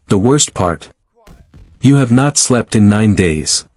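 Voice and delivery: ordinary delivery, nothing marked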